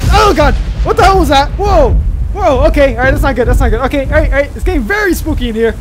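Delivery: loud, high yelp settling into uneasy chatter; startled fright. Real, not acted. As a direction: jump out of your skin, then try to steady yourself. Startled by scare in spooky game.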